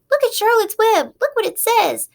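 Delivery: put-on high, loud excited child; excited cry. A narrator's reading. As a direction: call out in delight. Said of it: "cried Fern".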